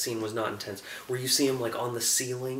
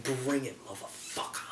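put-on defiant old hero; 7 dB quieter than usual. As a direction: spit the challenge quietly at the villain. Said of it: "he's basically just like".

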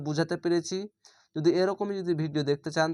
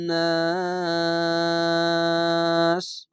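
slow chanted recitation, last word held long; teacher demonstrating tajweed; Quran recitation; much slower than usual. Shown as a demonstration. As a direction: chant it and stretch the final নাস. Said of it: "এখানেও গুণ না".

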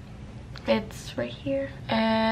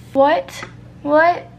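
loud, high rising disbelief; a squawk of disbelief; shocked what; 5 semitones higher, 8 dB louder than usual. Real, not acted. Staring at her ruined eye, a loud high "what".

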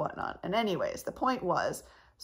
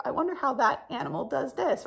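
lightly acted higher wondering voice; curious musing; 6 semitones higher than usual. Vlogger imitating her own thoughts about animals, curious. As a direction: lift the pitch as if pondering aloud.

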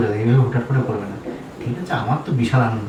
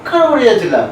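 indignant denial; 12 semitones higher than usual. Acted. Husband denying the visit to his wife, high indignant cry. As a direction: act offended to cover up.

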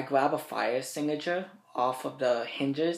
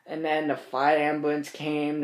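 ordinary talk

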